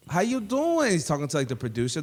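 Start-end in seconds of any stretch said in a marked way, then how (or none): 0.1-0.9 Mauricia. Retold or imitated.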